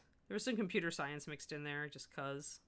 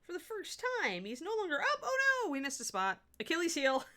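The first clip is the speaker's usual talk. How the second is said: playful excitement; 11 semitones higher, 4 dB louder than usual. Real, not acted. Playful excitement over painted foot.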